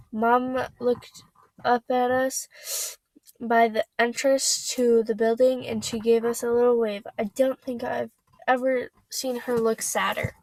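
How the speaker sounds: ordinary delivery, nothing marked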